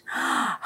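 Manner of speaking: quick sharp in-breath; sharp panting gasp. Shown as a demonstration. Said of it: "start with the breath first".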